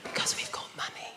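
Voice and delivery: hushed middle-class whisper. Shown as a demonstration. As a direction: whisper it like posh people. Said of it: "low talkers".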